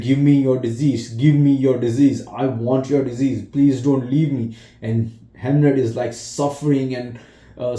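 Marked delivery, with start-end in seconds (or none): 0.0-4.6 louder, higher begging, close to tears; desperate pleading. Retold or imitated. Mother begging her dying son, louder pleading. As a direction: beg him to give you the sickness. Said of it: "She's like".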